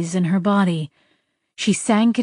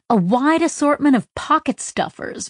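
usual speaking voice

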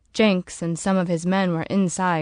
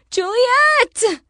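high, loud scream of her name; 6 dB louder than usual. A narrator's reading. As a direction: scream it in disbelief. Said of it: "she screamed".

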